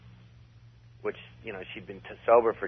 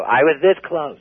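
Jackie Mason bit; 5 semitones higher, 7 dB louder, slower than usual. Retold or imitated. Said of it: "like, you know, Jackie Mason".

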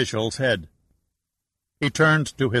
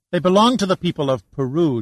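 a flash of anger, higher, louder and fast; angry; 8 semitones higher, 6 dB louder, faster than usual. Acted. Shannon interrupts the Mexican official, angry and fast. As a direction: cut in on him, indignant. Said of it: "her face flushed with anger".

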